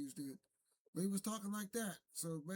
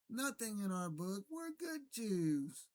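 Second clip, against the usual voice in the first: mocking impression; 4 semitones higher, slower than usual.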